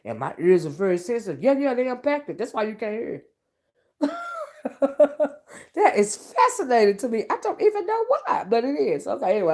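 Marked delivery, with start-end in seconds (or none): 4.0-5.3 a squealing high laugh of delight. Real, not acted. A vlogger laughing high at her own earwax tale. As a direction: let a giddy laugh burst out up high.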